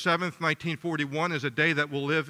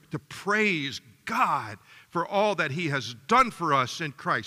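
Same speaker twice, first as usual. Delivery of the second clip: ordinary talk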